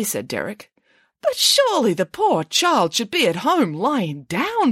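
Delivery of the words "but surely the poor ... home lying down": higher pig voice, indignant; protesting. A narrator's reading. Nanny Piggins protests to the children, high and indignant. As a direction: object on the poor child's behalf. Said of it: "protested Nanny Piggins".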